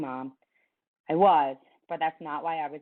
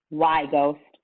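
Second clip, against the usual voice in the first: louder, insistent why; pressing demand; 7 dB louder than usual. Acted. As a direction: push him for an answer. Coach pressing the question.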